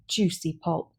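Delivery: plain reading voice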